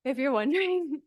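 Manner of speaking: breathless after climbing stairs; puffing, catching your breath. Real, not acted. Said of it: "I'm kind of out of breath 'cause I just walked up the stairs".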